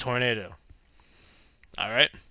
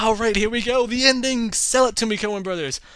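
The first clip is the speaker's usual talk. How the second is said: higher, louder hyped cheer; eager excitement; 8 semitones higher, 5 dB louder than usual. Pretend. Fan urging the Coen brothers on, higher and louder. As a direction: cheer them on to deliver.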